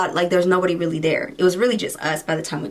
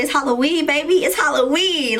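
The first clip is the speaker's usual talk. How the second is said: higher, playful hype; higher sing-song Halloween brag; excited sing-song; 6 semitones higher than usual. Retold or imitated. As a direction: brag that it's Halloween with a lift. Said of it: "'cause I said".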